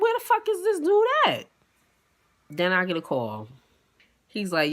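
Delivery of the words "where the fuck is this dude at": high exasperated outburst; fed up, looking round for him. Retold or imitated. Stranded at one in the morning, exasperated at her date.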